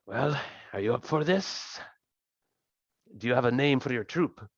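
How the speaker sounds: lightly acted NPC questioning; in-character question. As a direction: sound out a new ally, in character. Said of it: "She looks at you".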